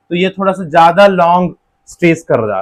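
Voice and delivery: ordinary delivery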